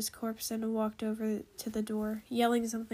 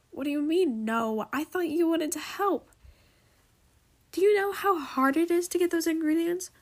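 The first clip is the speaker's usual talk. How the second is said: higher-pitched, heated arguing; getting upset; 5 semitones higher, 4 dB louder than usual. A narrator's reading. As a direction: argue back with rising indignation. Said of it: "Knowledge was starting to get upset".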